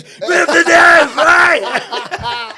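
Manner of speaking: high, loud schoolyard jeer; taunting shout. Retold or imitated. Playing the brother taunting the old neighbour, loud and high. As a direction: yell the nickname right in his face. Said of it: "grab him by the ears".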